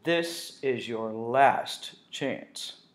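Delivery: very slow, stern words; slow warning. Acted. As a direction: spell out each word slowly. Written warning read slowly.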